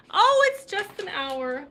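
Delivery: loud, high, stretched exclamation; sudden drawn-out outburst of surprise; surprised. Real, not acted. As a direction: exclaim it as you notice the time. Just noticed the time, loud, high.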